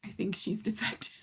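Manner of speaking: conspiratorial whisper; joking whispered aside to viewers. Real, not acted. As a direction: whisper it so the cat won't hear. Aside joke about the cat.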